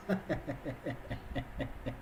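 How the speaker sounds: low, soft run of chuckles; real chuckle. Real, not acted. Streamer amused at own accent boast, chuckling low. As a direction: chuckle quietly to yourself. Laughs after "I don't care.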